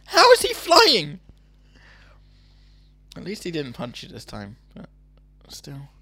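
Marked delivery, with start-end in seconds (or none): 0.1-1.2 high, loud, drawn-out astonishment; incredulous shout. Pretend. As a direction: cry out in mock disbelief. Amazed at pretend flying exit.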